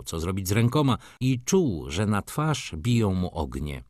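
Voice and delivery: usual speaking voice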